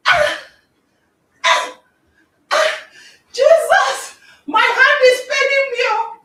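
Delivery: loud, high, mock cries of pain; hammed-up pain cries. Window-breaker hurting his hand, mock pained cries. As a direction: ham up yelping over a hurt hand.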